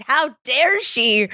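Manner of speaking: high, clipped mock indignation; indignant. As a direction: spit it out, offended for her friend. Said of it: "I was just so frustrated".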